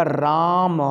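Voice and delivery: "राम" drawn out slowly, last vowel lengthened; lingering, elongated "राम"; stretched pluta vowel. Shown as a demonstration. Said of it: "हमें खींचना है".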